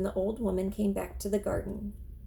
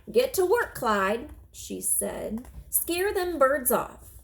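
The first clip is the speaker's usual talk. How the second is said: put-on old woman ordering; 4 semitones higher, slower than usual. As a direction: bark it at the scarecrow.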